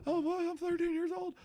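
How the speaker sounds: put-on high, soft squeaky teen voice; mock a voice that hasn't broken; squeaky teenage boy mockery. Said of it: "mocking scout".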